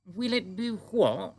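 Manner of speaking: Queen greeting the giant calmly; composed Queen. A narrator's reading. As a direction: stay perfectly poised, royal. Queen voiced in story.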